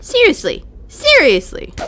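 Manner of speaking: loud, high, falling disbelief; exasperated. Real, not acted. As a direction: throw it at the game in indignation. Indignant at the game.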